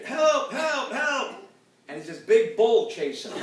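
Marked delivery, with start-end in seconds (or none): 0.0-1.4 high, panicked cries for help, a bit hammed-up; mock screaming for help. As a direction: yell help as the bull closes in. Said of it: "he hears the guy screaming".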